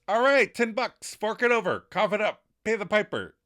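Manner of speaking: loud, high demand for payment. Retold or imitated. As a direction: call in the bet, loud. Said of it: "And Chandler demands".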